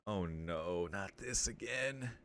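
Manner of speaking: usual speaking voice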